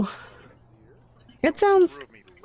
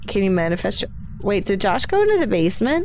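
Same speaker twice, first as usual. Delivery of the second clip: usual speaking voice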